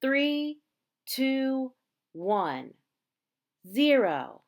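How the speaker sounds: loud final countdown; countdown call. A narrator's reading. Reading to children, calling the final countdown loudly. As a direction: call out the last numbers.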